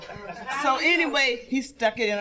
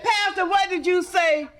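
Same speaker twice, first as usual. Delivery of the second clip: put-on old lady calling out; 7 semitones higher than usual. Old lady calls to pastor.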